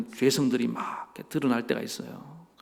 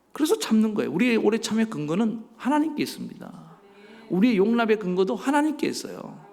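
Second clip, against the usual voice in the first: raised pulpit voice, pressing for an amen; impassioned preaching; 7 semitones higher than usual. Real, not acted. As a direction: preach it with full conviction. Preaches at full force.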